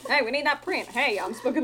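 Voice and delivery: put-on New York accent. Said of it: "he's just from New York".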